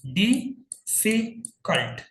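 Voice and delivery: teacher sounding out डिफिकल्ट one syllable at a time; three separate syllables. Shown as a demonstration. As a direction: split डिफिकल्ट into डि, फि, कल्ट. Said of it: "three syllable".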